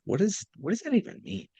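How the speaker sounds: high, puzzled, quiet; high confused voice. Retold or imitated. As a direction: ask it in a high baffled voice. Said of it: "I was like".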